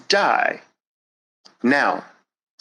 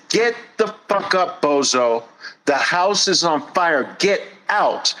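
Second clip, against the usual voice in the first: high, urgent yelling command; rough order to flee the fire; harsh shake-up; 4 semitones higher than usual. Shown as a demonstration. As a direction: shout him awake, no mercy. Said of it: "violently take you by your frickin' shoulders ... and say".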